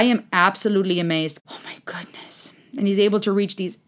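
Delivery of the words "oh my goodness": whispered amazement; awed whisper. Real, not acted. Amazed by the singer's performance, awed whisper. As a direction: whisper it in awe.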